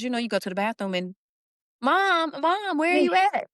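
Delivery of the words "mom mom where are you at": put-on high, loud little-kid voice calling Mom; toddler calling. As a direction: call out like a needy toddler.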